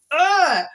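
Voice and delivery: high, loud "ugh" of frustration; exasperated groan. Real, not acted. Frustrated at being silenced.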